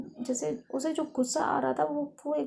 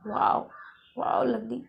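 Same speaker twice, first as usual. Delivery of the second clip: scornful anger; 4 semitones higher than usual. Acted. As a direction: throw the sorry back at her. Faiz scorning the apology.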